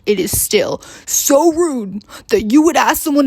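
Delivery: imitating an offended man still complaining; mock outrage, putting on his voice; hammed-up indignant complaint; indignant retold scolding. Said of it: "he was still yelling at me".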